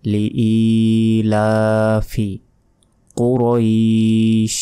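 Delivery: লিইলাফি কুরাইশ very slow, long ee, aa and final ee; measured recitation with drawn-out vowels; tajweed elongation. Shown as a demonstration. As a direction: chant it slowly, holding each long vowel. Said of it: "লাতে এক second টান হবে".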